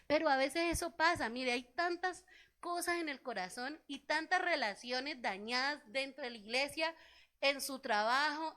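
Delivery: usual speaking voice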